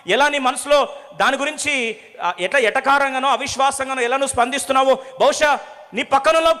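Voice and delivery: loud, high preaching with rising fervour; full-force preaching. Acted. A preacher challenging the congregation's doubts, loudly. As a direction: drive the warning home to the congregation.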